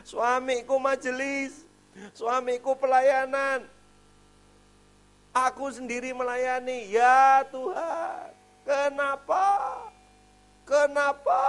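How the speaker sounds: high, loud, slow wailing plea to God; the grieving mother crying out to God, high, loud and slow; mother's anguish. Retold or imitated. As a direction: wail it up to heaven, drawn out. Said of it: "Pertanyaannya sederhana".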